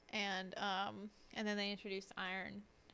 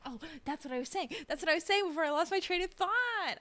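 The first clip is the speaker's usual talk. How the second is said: high, loud burst of triumph; sudden excited realization; 8 semitones higher, 7 dB louder than usual. Real, not acted. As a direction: shout it out as the lost thought returns. Suddenly recalls her lost thought.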